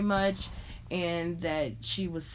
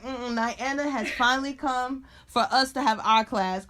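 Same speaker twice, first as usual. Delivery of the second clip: higher, louder sassy schoolgirl voice; imitating the pupil; 4 semitones higher, 4 dB louder than usual. Pupil pushing classmates aside for her teacher, sassy and loud. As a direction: shove past everyone with a bossy girl's voice.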